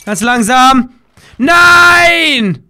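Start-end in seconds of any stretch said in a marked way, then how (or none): 1.4-2.6 long, high, loud no; real shout of dismay. Real, not acted. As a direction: cry out in dismay. Gamer dismayed.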